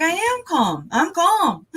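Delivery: high, animated insistence; animated protest. Retold or imitated. Answering police who told her to calm down, animated and high. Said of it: "I start talking like this and animated".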